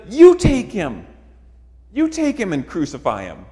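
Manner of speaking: frustrated Pilate. Retold or imitated. Pilate taunting the religious authorities, loud frustrated retort. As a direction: throw it back at them in mocking exasperation. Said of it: "He's frustrated".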